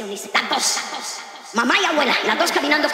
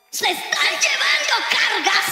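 high, slow shouted preaching; 12 semitones higher, slower than usual. Real, not acted. Preacher shouting to the mothers in church. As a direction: cry it out from the pulpit.

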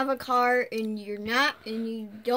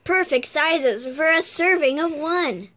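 slow, louder mock ad read; commercial voice; 4 semitones higher, 5 dB louder than usual. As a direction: sell it like a candy commercial. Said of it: "Let's do one of those M and M commercials".

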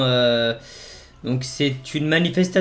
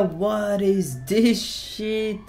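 staring at the riot, drawn out; stunned disbelief; 5 semitones higher, much slower than usual. Real, not acted. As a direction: let it hang in disbelief.

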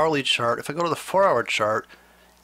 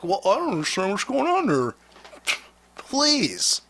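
mock whiny newbie; 4 semitones higher than usual. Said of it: "You're asking me, like".

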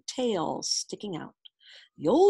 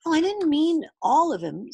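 put-on high, earnest child voice; eager boy; 8 semitones higher, 5 dB louder than usual. A narrator's reading. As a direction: explain it innocently as a kid. Boy explaining in story.

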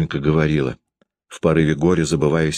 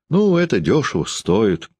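higher, firm dismissal; decisive; 7 semitones higher than usual. Acted. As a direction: settle it flatly, no argument. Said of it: "решительно сказала Дарья".

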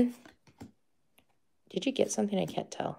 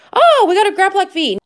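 excited; 11 semitones higher, 6 dB louder than usual. Real, not acted. Excited pull from a pack.

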